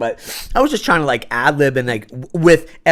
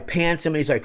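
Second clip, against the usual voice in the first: normal talking voice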